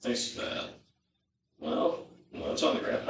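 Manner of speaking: breathy whisper to himself. Real, not acted. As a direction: mutter it under your breath. Muttering to himself while cooking.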